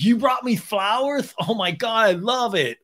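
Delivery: high, loud over-the-top delight; mock gushing. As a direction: imitate someone thrilled by a gift, hammed up. Mocking Sandoval's delighted reaction.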